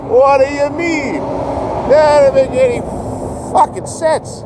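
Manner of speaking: high, loud, disbelieving; can't believe what he's hearing, slow it down; incredulous outburst. Real, not acted. Vlogger ranting at viewers about fake homeless creators, incredulous and loud.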